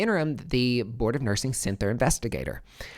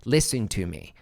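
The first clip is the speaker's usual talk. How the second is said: ordinary delivery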